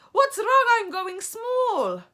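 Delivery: very high, loud, slowing wail; crying out. A narrator's reading. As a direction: shrink as you cry. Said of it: "cries out".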